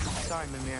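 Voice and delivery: usual speaking voice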